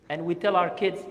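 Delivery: ordinary delivery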